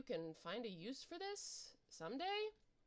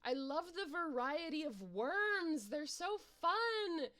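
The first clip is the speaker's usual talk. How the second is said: higher, louder, gushing delight; excited; 7 semitones higher, 5 dB louder than usual. Real, not acted. Player gushes to viewers about the worms, high and gleeful. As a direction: gush about the worms with real glee.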